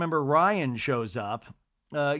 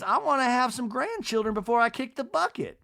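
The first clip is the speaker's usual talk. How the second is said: lightly voiced Southern mother; 9 semitones higher than usual. Voicing Miss Patricia.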